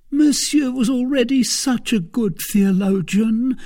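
high, loud tearful lament; almost weeping. A narrator's reading. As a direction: wail it as if close to tears. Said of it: "almost weeping".

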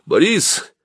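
high-pitched hail; calling out. A narrator's reading. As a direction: call to someone across a slope. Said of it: "крикнул он мне".

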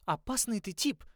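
high grumbling complaint; complaining. A narrator's reading. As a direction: complain half-admiringly. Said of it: "пожаловалась Берта".

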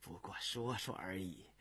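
low, soft and rueful; wry resignation. A narrator's reading. Official admits it is only talk, rueful. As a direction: brush it off with resignation. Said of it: "寇明苦笑着说".

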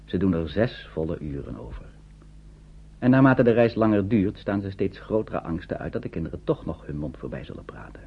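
ordinary talk, nothing marked

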